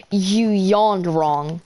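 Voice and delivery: slow, stretched-out words, lightly imitated; drawn-out mock accusation. Substitute faulting a student's yawn, slow mock accusation.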